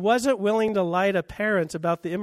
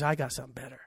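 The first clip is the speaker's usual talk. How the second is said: low, soft, understated aside as God; quiet divine answer; 6 semitones lower, 10 dB quieter, much faster than usual. Acted. Preacher voices God answering the upsurge of immorality, low and quiet. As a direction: drop it low and soft, like a secret.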